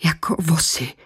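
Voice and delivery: faint whisper. Acted. Anna whispers what the coworkers were like. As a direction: breathe the words out numbly. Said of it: "řekla potom".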